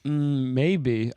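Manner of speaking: usual speaking voice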